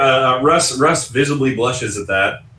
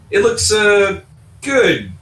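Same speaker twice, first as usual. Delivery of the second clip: unconvincing lie; 9 semitones higher, slower than usual. Acted. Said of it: "You're a terrible liar".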